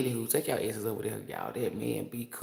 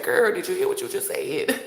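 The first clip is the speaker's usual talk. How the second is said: much higher, louder squeal of disbelief; her spirit's voice; 11 semitones higher, 6 dB louder than usual. Retold or imitated. Her spirit shocked at her using the word kneading, squealing. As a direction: shriek it as her own startled inner voice. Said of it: "my spirit jumped out my flesh and said".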